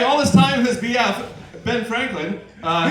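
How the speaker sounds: usual speaking voice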